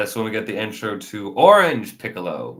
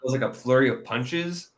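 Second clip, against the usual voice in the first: usual speaking voice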